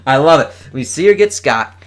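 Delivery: ordinary delivery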